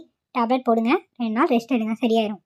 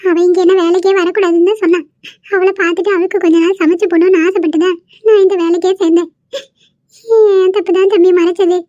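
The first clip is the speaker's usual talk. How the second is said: crying through the words, slowing at the end; sobbing out an apology to the young man; tearful confession; 3 semitones higher than usual. Acted. Grandmother crying as she confesses her secret to the young man, tearful.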